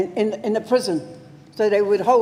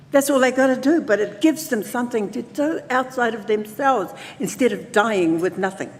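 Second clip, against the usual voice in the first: ordinary talk, nothing marked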